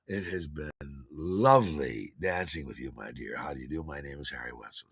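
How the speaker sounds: low, suave gentleman's greeting; courtly introduction. Retold or imitated. Dancer introducing himself to his partner, courtly and low.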